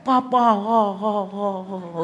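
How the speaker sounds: drawn-out slow-mo call, fading away; high start, stretched echoing syllables dying off; slow-motion movie cry. Retold or imitated. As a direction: mimic a child calling in film slow motion. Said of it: "slow mo kayak film-film India".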